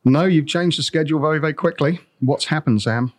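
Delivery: normal talking voice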